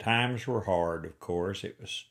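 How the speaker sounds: ordinary talk, nothing marked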